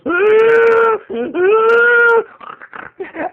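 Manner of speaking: loud, high snorting pig laugh; dad's pig laugh. Retold or imitated. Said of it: "My dad goes".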